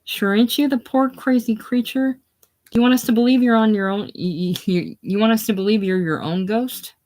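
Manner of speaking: high mocking pity; high scornful pity; pitying contempt. A narrator's reading. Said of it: "with pity and contempt".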